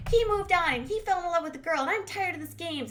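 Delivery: high-pitched mock complaining; hammed-up exasperated griping; yammering rant. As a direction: rattle off grievances in a put-on indignant voice. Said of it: "they're, like, yammering on".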